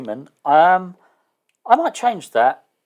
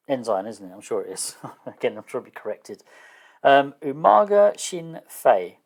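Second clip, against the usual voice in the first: ordinary talk, nothing marked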